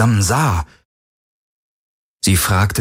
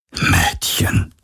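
hushed, whispered address; quiet, whispery murmur. Acted. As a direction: say it low, almost without voice. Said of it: "sagte er leise".

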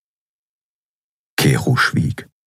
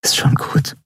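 whisper; 11 semitones higher than usual. A narrator's reading. Endriel comforting the crying Skria, whispering. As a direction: breathe it gently, no voice. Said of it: "flüsterte sie".

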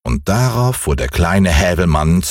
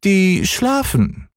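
hoch und gedehnt gerufen; kleine Katze; 12 semitones higher, slower than usual. Acted. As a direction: von oben verspielt herabrufen. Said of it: "rief die kleine Katze herunter". (hohe, langsame Katzenstimme)

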